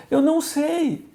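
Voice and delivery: tearful panic. Retold or imitated. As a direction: say it like a frightened woman who is lost.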